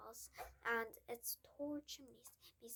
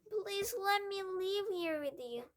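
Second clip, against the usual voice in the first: 1 tearful plea; 9 semitones higher, 8 dB louder than usual. A narrator's reading. Matilda begging through tears.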